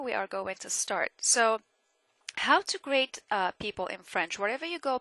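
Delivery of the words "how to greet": usual speaking voice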